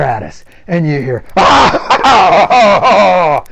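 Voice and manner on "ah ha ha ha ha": booming high monster laugh. Acted. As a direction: cackle like a chained beast. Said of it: "like this".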